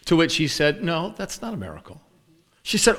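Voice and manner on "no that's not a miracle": soft, quick matter-of-fact denial; retold denial. New believer answers the cat owner, soft casual denial. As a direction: play down the excitement quietly.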